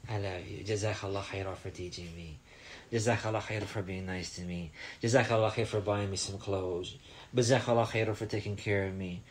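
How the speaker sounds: soft, sincere gratitude; child softly thanking mom; tender thanks. Shown as a demonstration.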